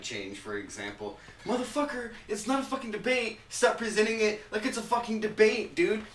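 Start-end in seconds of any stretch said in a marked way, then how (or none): 1.5-6.0 much higher, heated outburst; angry rant. Real, not acted. Ranting at TV news for staging fake debates, heated higher outburst. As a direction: vent at them, pitch climbing.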